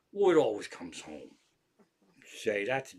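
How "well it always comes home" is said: low, dismissive mock quote; mimicked cat owner. Mocking neighbor who let her cat out, mimicked excuse. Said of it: "How could you let your cat out going".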